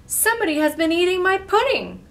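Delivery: loud, high, fast outcry from a storybook bear; great big Papa Bear voice. A narrator's reading. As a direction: boom it out as the big indignant bear. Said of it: "in his great big voice".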